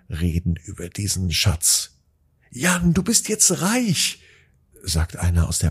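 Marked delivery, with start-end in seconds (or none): 2.5-4.2 high, slow and amazed; awed exclamation. Acted. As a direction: marvel at him, drawn out. Classmate exclaims in awe.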